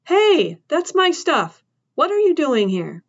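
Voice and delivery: put-on high, startled cry of a boy woken up. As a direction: call out in alarm, voice jumping up. Boy startled, demanding answers.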